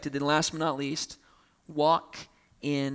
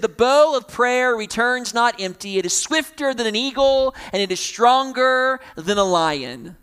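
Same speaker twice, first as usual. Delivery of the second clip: proclaiming; 5 semitones higher, 5 dB louder than usual. Acted. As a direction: declare it like a ringing pulpit quote. Quoting Spurgeon with force.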